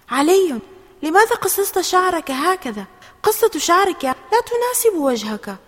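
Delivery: high, loud disbelieving question; astonished. A narrator's reading. Mother questioning Ali about his new haircut, astonished. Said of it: "وسألته بتعجب".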